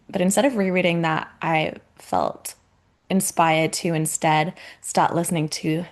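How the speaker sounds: normal talking voice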